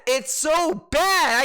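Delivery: real exasperated outburst; exasperated yell. Real, not acted. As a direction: drag it out in disgust. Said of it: "yelling like a madman".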